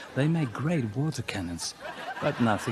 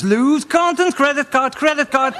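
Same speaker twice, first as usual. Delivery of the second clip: high, loud credit card credit card; mock frantic; 12 semitones higher, 8 dB louder than usual. Comic mocking himself grabbing his credit card, high and loud. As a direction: scramble for the card eagerly.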